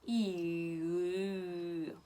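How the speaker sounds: long I pulled back into the Russian ы; I sliding into ы. Shown as a demonstration. Teacher shows the mouth move from I, gliding into ы. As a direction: start on I, draw the tongue back and down. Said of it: "empezamos con I".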